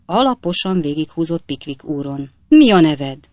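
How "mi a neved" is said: loud, stern demand. Acted. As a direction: demand an answer with raised voice. Captain interrogates the intruder.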